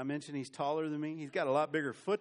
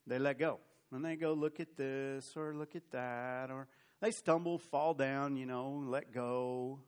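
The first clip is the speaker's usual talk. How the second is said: normal talking voice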